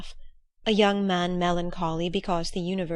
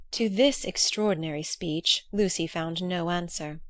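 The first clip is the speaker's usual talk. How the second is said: usual speaking voice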